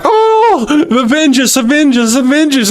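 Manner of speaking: high, far-off wailing cry of trapped souls. Retold or imitated. Souls under the throne crying out, high wailing plea. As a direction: moan it like souls begging for vengeance. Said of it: "they're like".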